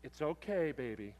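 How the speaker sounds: soft, low and patronising; the nurse calming the nervous father, soft and low; soothing nurse. Retold or imitated. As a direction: pat him on the head with your voice.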